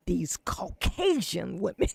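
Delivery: laughing hard and loud; loud laughter. Real, not acted. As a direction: crack up loudly. Cohost laughing loudly.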